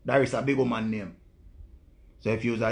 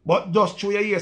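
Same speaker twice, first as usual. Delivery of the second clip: loud rant; 8 semitones higher, 6 dB louder than usual. Real, not acted. Ranting at the woman.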